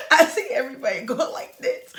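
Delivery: laughing while retelling, high and loud. Real, not acted. Mother recalling scrubs rushing around, laughing. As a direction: crack up as you say it.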